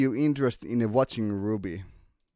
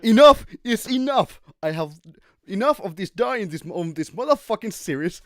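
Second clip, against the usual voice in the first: higher, louder mock fed-up rant; 7 semitones higher, 5 dB louder than usual. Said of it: "The Salem's gonna be like".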